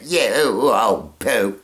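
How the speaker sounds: put-on very slow, drawn-out old-movie-star voice; Katharine Hepburn impression. Trying out impressions, he does a slow, haughty Katharine Hepburn. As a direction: drag it out like a haughty screen legend. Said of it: "or maybe like Katharine Hepburn say".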